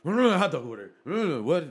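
high, loud dazed exclamation; sleep-talk mimic. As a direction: mimic a half-asleep outburst.

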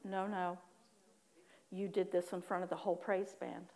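low, soft, firm reprimand; stern correction. Retold or imitated. Holy Spirit correcting her before the praise band, quiet and firm. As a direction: quietly imitate a firm inner rebuke.

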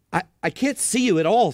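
stammer, straining to see; Shasta, frightened. Acted. Boy staring into the dark at the creature, frightened. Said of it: "said Shasta".

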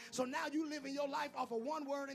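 full-force sermon; preaching. Acted. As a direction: hammer each line home.

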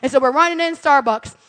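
usual speaking voice

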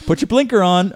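loud mock instruction; escalating comedy rant. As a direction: shout it like driving lessons. Stillborn baby driving joke.

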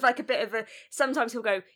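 ordinary talk

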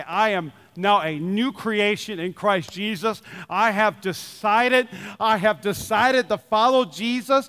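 slow and deliberate, ending very slow; new believers declaring their faith, slowly; slow testimony. Retold or imitated. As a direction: speak it like a solemn vow. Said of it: "It's amazing to see those say".

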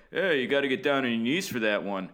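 lightly voiced gruff announcer; a hint of Bill Schroeder; Schroeder impression. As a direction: toss it off like a broadcaster. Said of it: "And Schroeder was like".

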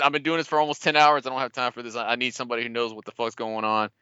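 normal talking voice

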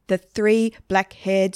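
ordinary delivery

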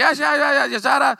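imitating the Cajun secretary who never stops talking; mock Cajun chatter. Secretary who talks nonstop, imitated as babble. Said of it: "that monotone voice".

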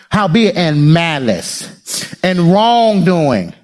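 very slow, drawn out; read real slow. Shown as a demonstration. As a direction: lean on each word slowly. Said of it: "I'm gonna read it real slow".

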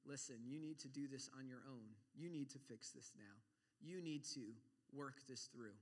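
low, hushed self-talk; inner tempting voice. Acted. Preacher voices temptation saying go it alone, quiet inner voice. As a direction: quietly press the point, as a thought in the head.

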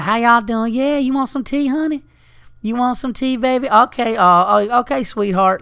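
mother them like a Memphis waitress; sweet Southern woman. Retold or imitated. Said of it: "that's how they talk down here".

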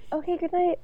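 soft, high mock-meek sign-off; meekly polite. Co-host echoing Sean's exit, meekly polite. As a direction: imitate a small, obliging goodbye. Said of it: "at least he's polite".

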